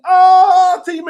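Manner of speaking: high, loud ah; loud exclamation. Real, not acted. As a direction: burst out with delight.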